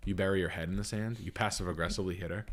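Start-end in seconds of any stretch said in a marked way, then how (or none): none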